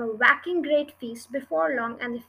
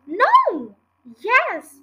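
excited exclamations; 8 semitones higher, 10 dB louder than usual. A narrator's reading. Excited exchange over good news.

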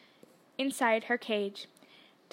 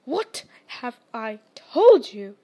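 loud, slow, booming anger; furious uncle thundering at Harry; 2 semitones lower, 9 dB louder, slower than usual. A narrator's reading. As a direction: thunder it down at the nephew. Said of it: "thundered his uncle".

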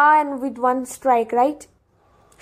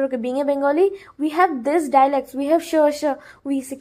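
normal talking voice

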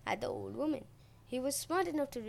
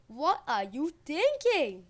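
slow, loud, higher-pitched outburst; angry shout; 5 semitones higher, 9 dB louder, slower than usual. A narrator's reading. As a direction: shout it in outrage, stretching each word. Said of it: "Demeter shouted".

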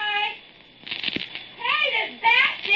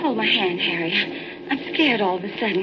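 sudden fear; 10 semitones lower, much faster than usual. Acted. Sudden fright in the cave.

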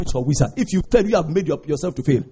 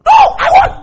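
defiant; 8 dB louder than usual. Retold or imitated. Beggar refusing to be quiet.

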